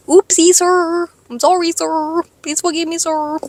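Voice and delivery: high, babyish mock pleading; cutesy apology. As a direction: beg forgiveness like a tiny child. Mock-childish apology to God.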